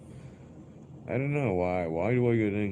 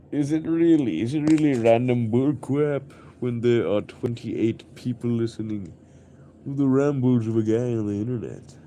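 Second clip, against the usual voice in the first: loud, higher incredulous rant; indignant; 4 semitones higher, 6 dB louder than usual. Real, not acted. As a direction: demand an answer, offended.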